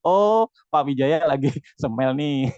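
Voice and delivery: amused, drawn-out realization with a chuckle; slow, smiling mock surprise; smiling reaction. Shown as a demonstration. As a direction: act out a pleased reader, laughing. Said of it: "harus dikasih smile".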